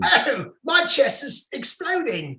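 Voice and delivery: high, hammed-up Australian panic; attempted Australian accent. Host playing a stricken Australian adventurer, mock Australian accent. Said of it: "trying to go for an Australian accent".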